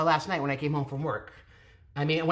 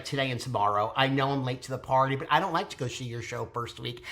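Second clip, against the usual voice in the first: ordinary talk, nothing marked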